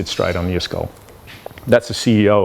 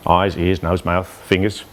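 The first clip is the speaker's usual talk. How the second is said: ordinary delivery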